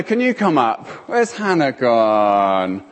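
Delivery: usual speaking voice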